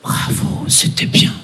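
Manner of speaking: hushed, breathy whisper; whispered compliment. Retold or imitated. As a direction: whisper it like a prim lady. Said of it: "elle me dit".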